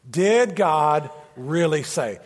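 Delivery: slow, weighty, stress on Did; slow emphasis. Shown as a demonstration. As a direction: let each word land slowly. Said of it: "Say it with me out loud".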